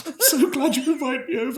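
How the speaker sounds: high, laughing mock thanks. Real, not acted. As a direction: laugh through the words.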